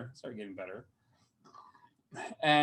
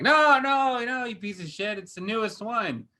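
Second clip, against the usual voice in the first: higher, burst-out "No, no" that settles down; fake-indignant outburst, pitched up; mock outburst; 8 semitones higher than usual. Recalling the film's twist to his co-host, mock outburst. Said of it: "it's like".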